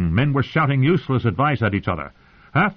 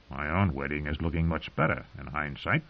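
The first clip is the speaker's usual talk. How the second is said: hushed inner thought; 7 semitones lower, 10 dB quieter than usual. Acted. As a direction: murmur it to yourself.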